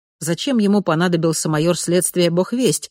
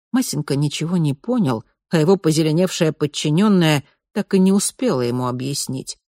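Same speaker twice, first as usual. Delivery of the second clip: usual speaking voice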